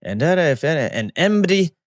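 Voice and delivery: imitating Moyes in a broad Glaswegian accent. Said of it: "Moyes kept saying".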